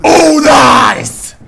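loud happy yell; excited shout. Real, not acted. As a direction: cheer the kill out loud. Shouting after a kill.

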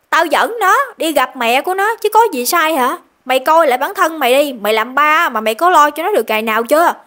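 loud, high angry rebuke; grandma scolding. Retold or imitated. Grandmother rebukes father for never caring for his son, angry. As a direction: tear into your grown son. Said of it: "Bà nội chửi ba quá trời luôn".

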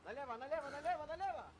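urgent shout. Real, not acted. Directing driver urgently.

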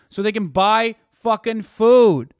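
slow, loud punch on fucking food; angry shout. Real, not acted. Ranting streamer shouts at people blaming the poor. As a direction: hammer it home, slow and loud.

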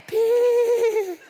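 very high, loud squeaky peep; tiny peep. Acted. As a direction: squeak it out like a cheeky kid. Kid defiantly making one peep.

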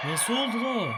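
loud, high disbelieving cry; shocked shout. Real, not acted. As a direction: yell it in sudden disbelief. Teammate shocked by sudden catch.

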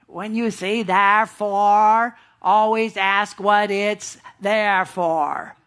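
slow, drawn-out southern drawl; slow down and drawl it like an old southern radio preacher; J Vernon McGee's southern drawl. Retold or imitated. Preacher imitates McGee's saying, slow southern drawl. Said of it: "the guy that kind of talked like this".

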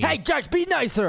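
high-pitched scolding of a friend. Acted. Friend scolds Jax in quarrel.

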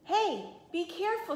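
high, sharp warning cry; startled shout. A narrator's reading. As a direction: call out as the bike skids. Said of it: "she shouted".

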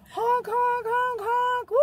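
high squeaky honk calls; honking mock cheer. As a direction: chirp the honks up high. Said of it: "I'm gonna go".

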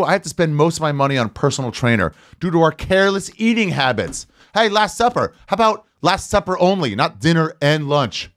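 normal talking voice

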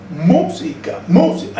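MÚ-si-ca, first syllable leaping up; música in rhythm, heavy first beat; strong accent on MÚ. Shown as a demonstration. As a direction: punch the MÚ in música high and hard. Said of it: "acento fuerte".

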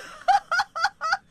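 high, loud burst of laughter; helpless laugh. Real, not acted. Player laughing as he dies, high and loud. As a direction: crack up in a high cackle.

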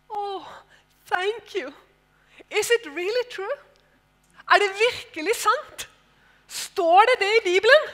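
high, rising amazed questions; sincere surprise. Retold or imitated. The stewardess asking if the Bible really says so, sincerely amazed. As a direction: marvel at it, earnestly. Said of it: "helt oppriktig".

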